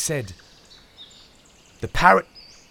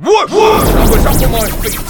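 excited shout; 13 semitones higher, 13 dB louder than usual. A narrator's reading. Dog leaps up startled.

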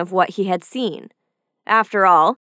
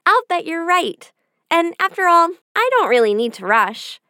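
put-on higher, carefree little girl voice; an easily tempted child, pitched up; 7 semitones higher than usual. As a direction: naive young girl agreeing brightly. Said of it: "the little girl said".

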